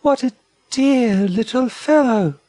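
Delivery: high, adoring wallaby voice; excited wallaby. A narrator's reading. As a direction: coo high over a baby. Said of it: "rounding with excitement".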